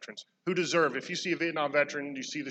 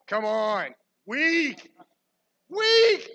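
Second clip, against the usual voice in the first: teasing; 9 semitones higher, 5 dB louder, much slower than usual. Acted. Taunting the crowd's weak shout.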